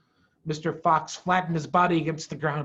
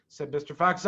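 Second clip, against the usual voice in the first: ordinary delivery, nothing marked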